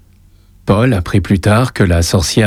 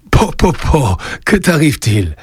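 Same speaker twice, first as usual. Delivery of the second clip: mild surprise, pitched up; astonished; 6 semitones higher than usual. A narrator's reading. Grandfather sees the boy rush in, mildly astonished. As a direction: react with a touch of astonishment. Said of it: "d'un air étonné".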